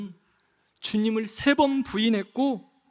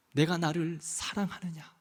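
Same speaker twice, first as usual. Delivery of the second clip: much softer and lower, 내가 leaned on, falling end; soft question; 8 semitones lower, 11 dB quieter than usual. Pretend. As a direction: ask it gently, almost under the breath. The Lord's question, voiced softly.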